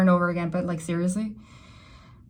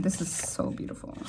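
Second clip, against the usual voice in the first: awed whisper; 10 dB quieter than usual. Real, not acted. Awed by the cards drawn.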